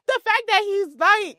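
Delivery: high, loud and drawn out; loud, slow disbelief; incredulous outburst. Real, not acted. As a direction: stretch it out in high-pitched disbelief. Amazed retort about the child.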